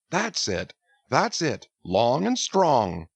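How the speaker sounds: jolly urging. A narrator's reading. Stubb cheering his crew to pull harder, jolly. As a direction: egg the crew on merrily.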